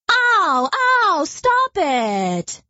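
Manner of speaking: high, yelping cries of a stung skunk; pained skunk cries. Acted. Stung skunk yelping at the bees, high and pained. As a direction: yelp as the bees sting you. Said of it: "she said, running back".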